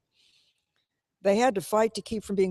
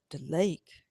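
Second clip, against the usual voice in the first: disbelief rising at the end; rising horrified question; 2 semitones lower, 3 dB quieter than usual. A narrator's reading. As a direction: ask it back, aghast. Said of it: "in amazed horror from Motorboat".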